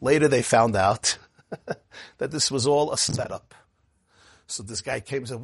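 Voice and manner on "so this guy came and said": ordinary talk, nothing marked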